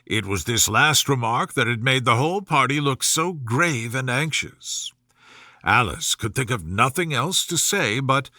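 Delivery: ordinary delivery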